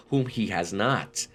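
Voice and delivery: ordinary talk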